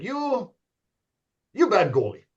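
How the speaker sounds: put-on blunt Russian-accented verdict; deadpan Russian coach putting down a goalie; mock Russian accent. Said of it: "That was my Russian accent pretty good?".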